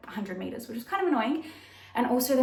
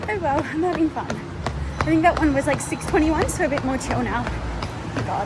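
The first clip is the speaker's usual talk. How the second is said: breathless runner, higher and louder; while running; 4 semitones higher, 6 dB louder than usual. Real, not acted. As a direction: talk between strides, catching breath. I'm taking a little break.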